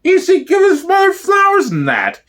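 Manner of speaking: put-on squeaky falsetto of a doting old lady; high old woman voice. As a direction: pitch it way up like a fond granny. Old woman interviewed about gangster.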